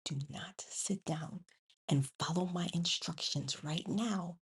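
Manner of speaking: stern whispered classroom command. Shown as a demonstration. Teacher whispering an order to her students, stern whisper. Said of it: "I'd be like".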